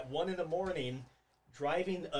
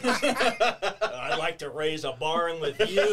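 slow mock-drunk pickup line; 6 dB louder, slower than usual. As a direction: lay it on slowly, half laughing.

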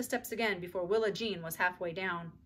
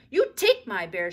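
louder, slow bossy command; ordering; 10 dB louder, slower than usual. A narrator's reading. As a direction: boss her around. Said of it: "she ordered".

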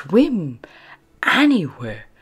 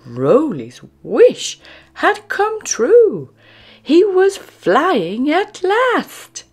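thrilled narration; 13 semitones higher, 8 dB louder, slower than usual. A narrator's reading. Penguin finally flies.

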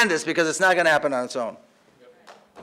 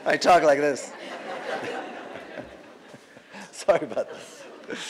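soft, lower, laughing through the words; laughing apology; 4 semitones lower, 8 dB quieter than usual. Real, not acted. Speaker laughing off an interruption at the mic, apologetic. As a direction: laugh it off sheepishly.

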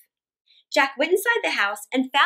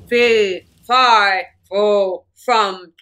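loud chant, then low slow growl; booming giant; 4 semitones lower, 7 dB louder, much slower than usual. Acted. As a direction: boom it out like a giant. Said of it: "the giant cried".

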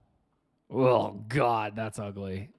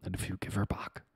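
mock whispered threat; 2 semitones lower, 5 dB quieter than usual. Continuing Taken quote, aborted.

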